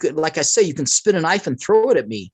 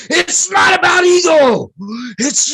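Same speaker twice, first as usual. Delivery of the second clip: much higher, louder and slow furious shout; Dalai Lama angry; 6 dB louder, slower than usual. Retold or imitated. Dalai Lama scolding the guest about his wax-figure question with a loud angry shout. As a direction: blast it like an offended holy man. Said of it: "so pissed off".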